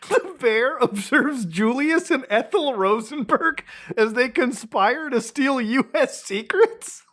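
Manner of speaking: slow, high, rising disbelief; incredulous reading. Real, not acted. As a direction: read it as if you can't believe it. Incredulous reading of absurd caption.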